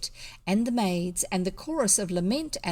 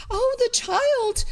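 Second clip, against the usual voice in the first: slow, much higher, louder wail; shrieking; 6 dB louder, slower than usual. A narrator's reading. The maids wail after the child, high and loud. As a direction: wail it out in horror. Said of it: "shrieked the maids".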